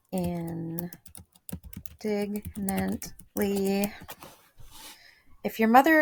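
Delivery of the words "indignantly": dictionary audio clip. Shown as a demonstration. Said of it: "vocabulary dot com".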